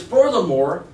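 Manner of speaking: loud, high declaration; Churchill rant. Retold or imitated. Quoting a wartime leader to the class, a loud, high declaration. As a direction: boom it out to rally the troops. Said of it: "ranting like this".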